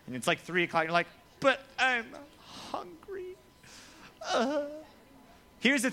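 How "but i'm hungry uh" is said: high, drawn-out mock whine; whiny kid. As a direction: whine like a starving schoolkid. Mimics hungry child after school.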